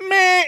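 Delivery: long, high buzzer bleat; door buzzer. Retold or imitated. Elevator warns the door is held, buzzer imitation. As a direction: drone like an elevator alarm. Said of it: "like, it buzzes you".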